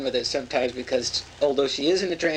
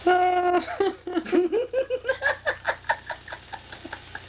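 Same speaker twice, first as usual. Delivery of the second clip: long helpless high cackle; laughing fit. Real, not acted. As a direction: burst into uncontrollable laughter. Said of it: "I don't know why I'm laughing".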